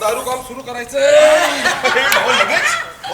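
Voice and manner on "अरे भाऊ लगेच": laughing tease, louder. Acted. Friend teasing the drinker, laughing loudly. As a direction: laugh through the words at the eager drinker.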